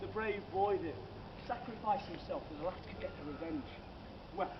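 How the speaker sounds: normal talking voice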